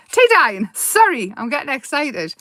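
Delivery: normal talking voice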